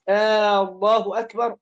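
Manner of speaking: "آه، الله أكبر", opening on a held aah like a question; lengthened first vowel. Shown as a demonstration. Teacher repeats the takbir not to say, first vowel stretched. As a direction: draw the hamza of الله out into a long آ. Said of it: "لا، لا تقل هذا".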